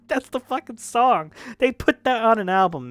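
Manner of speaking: high, loud incredulous rant; exasperated outburst. Real, not acted. Reviewer ranting about a hated album track, exasperated. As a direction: raise pitch and volume in disbelief.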